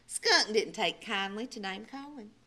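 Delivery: usual speaking voice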